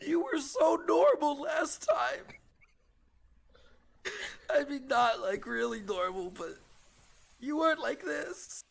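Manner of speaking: crying. Acted. Mac crying to the surfer, high blubbering sob-talk. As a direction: sob out the lost dream. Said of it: "Mac, like, starts to cry".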